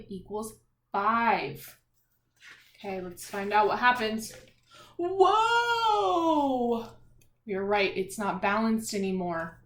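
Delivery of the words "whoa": surprised whoa. Acted. Seesaw tips over in story.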